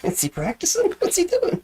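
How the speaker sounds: nosy onlooker. Retold or imitated. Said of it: "People start to wonder".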